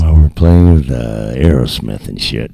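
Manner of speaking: very low, loud voice into the mic; put-on low voice. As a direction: drop right down low and keep it loud. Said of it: "Let's talk like this".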